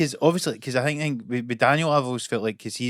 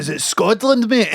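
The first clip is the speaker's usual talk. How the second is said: higher, louder sketch-style "Scotland"; sketch impression; 9 semitones higher, 8 dB louder, slower than usual. Scot quoting a famous sketch, higher and louder. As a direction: imitate the comedy sketch, pitched up and loud. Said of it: "the famous Burniston sketch".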